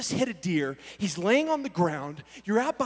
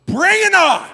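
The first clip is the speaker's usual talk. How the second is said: high, loud battle cry; crazy fighter's challenge; 8 semitones higher, 10 dB louder than usual. Retold or imitated. Fight type in an argument, yelled challenge. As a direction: yell it like a dare. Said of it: "crazy people like me".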